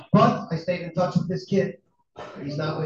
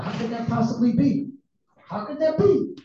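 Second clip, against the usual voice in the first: higher, retold disbelieving question; incredulous father; 6 semitones higher than usual. Father reacting to son's complaint, incredulous. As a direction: act out a parent's astonishment, pitch climbing.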